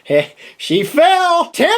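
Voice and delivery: high, slow drawn-out call of timber; mock alarm call. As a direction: sing out timber like a falling tree. Retelling tree crashing down.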